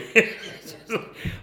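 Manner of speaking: high breathy chuckle; sheepish laugh; real laugh. Real, not acted. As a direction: laugh at your own foolishness. Laughing at himself.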